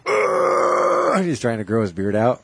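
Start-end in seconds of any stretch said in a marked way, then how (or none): 0.1-1.2 high strained mock groan; imitated groan. Retelling a short film, imitating a frustrated mock groan. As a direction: squeeze out a hammed-up frustrated groan. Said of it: "And he's just like".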